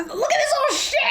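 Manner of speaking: squealing high through a laugh; giddy shriek mid-laugh. Real, not acted. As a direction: cackle it out in delight. Gleeful reaction to a scene.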